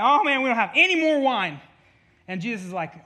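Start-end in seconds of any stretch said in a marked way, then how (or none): 0.0-1.6 loud, high-pitched panicked wail; freaking out. Acted. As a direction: act out wedding guests in a panic. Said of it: "everyone's freaking out".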